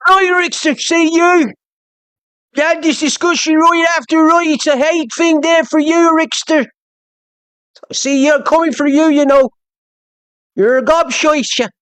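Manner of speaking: high, exaggerated Irish mimicry; mock Irish accent. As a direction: take the mickey with a squeaky Irish brogue. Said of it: "I keep mocking the Irish".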